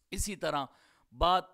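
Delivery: ordinary delivery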